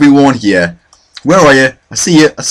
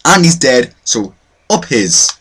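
ordinary delivery

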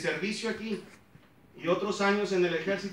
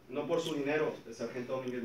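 ordinary talk

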